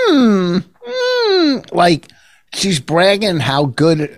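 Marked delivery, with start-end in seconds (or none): 0.1-1.7 high-pitched imitation of her food moan; mock savouring "mm". Husband imitating wife after a bite, hammed-up high "mm". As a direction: exaggerate a long, relishing hum. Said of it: "every time she goes".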